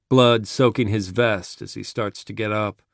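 ordinary delivery, nothing marked

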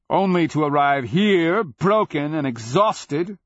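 loud, high cutting cry; cried out. A narrator's reading. Cleric taunting the dragon, crying out. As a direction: cry it out high, slowing at the end. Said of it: "Cleric cried".

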